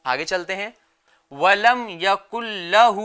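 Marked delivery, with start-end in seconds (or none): 1.3-3.0 slow, measured tajweed recitation; Quran recitation. Shown as a demonstration. Teacher models the verse for children, slow recitation. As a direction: recite slowly with drawn-out vowels.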